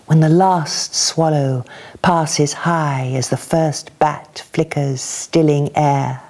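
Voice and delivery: measured, expressive poem reading with pauses; poetry reading. Acted. Reader sharing a poem with a gathered audience, expressive reading. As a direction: read it slowly, savouring each image.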